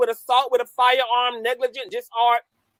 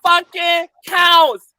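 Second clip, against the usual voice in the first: gloating taunt; 6 semitones higher than usual. Real, not acted. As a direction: rub it in their faces. Taunting the defendant's fans.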